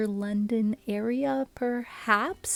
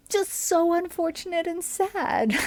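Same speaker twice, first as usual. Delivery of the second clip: laughing; 9 semitones higher, 3 dB louder than usual. Real, not acted. Sad story ends in a laugh.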